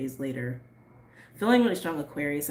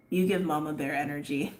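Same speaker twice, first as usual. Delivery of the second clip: ordinary delivery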